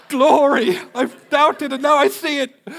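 high, loud, slow exultant cry; ecstatic praise. Retold or imitated. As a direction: shout glory like a victory. Exultant praise, mock triumph.

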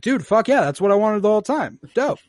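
high, loud mock-thrilled reply; excited agreement. Girl agreeing to leave with rescuers, excited. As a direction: burst out with gleeful approval.

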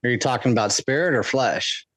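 ordinary talk

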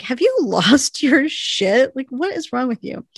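mock disbelief, high and loud at first; incredulous. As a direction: voice the friend's shocked outrage, half laughing. Winona reacts to shocking advice.

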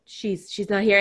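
wife gently explaining, understated tenderness; gentle explaining. Retold or imitated. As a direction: quietly tell a confused husband the truth. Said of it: "she has to explain".

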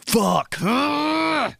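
high yell of embarrassment; high mock yell; cringe shout. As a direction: shout out the shame. Cringing at a memory.

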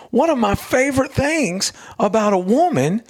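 high-pitched stand-up delivery, imitated; retold comedian. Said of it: "He's a comedian".

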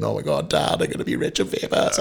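choked-up, voiceless fake sobbing; mock crying. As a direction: blurt it to dad through pretend happy tears. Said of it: "dropped the phone crying".

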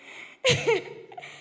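breathy giggle up high; high airy laugh. Real, not acted. Laughing at her own bargaining story, a high breathy giggle. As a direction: let a light laugh escape.

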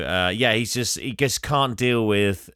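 normal talking voice

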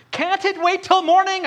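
put-on sleepy boy begging to be left alone; whiny teen. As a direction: son whining at his mother at 3 a.m. Sleepy teen protesting mom.

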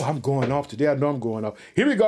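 ordinary delivery

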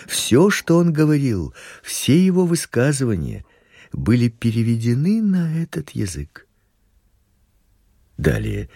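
ordinary delivery

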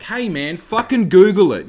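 loud, fed-up snap; exasperated retort. Retold or imitated. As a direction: throw it back at them, exasperated. Retorting to clueless designer.

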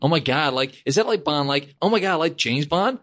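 hammed-up fan gush; imitating excited fans. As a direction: squeal it like a starstruck tourist. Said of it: "Is that what they're like?".

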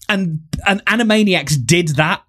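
ordinary talk, nothing marked

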